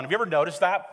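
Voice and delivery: ordinary delivery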